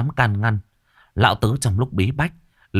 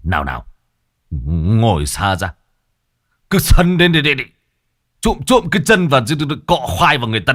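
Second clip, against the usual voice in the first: put-on flustered old shaman; 5 dB louder than usual. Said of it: "Nhị Tuét lắp bắp".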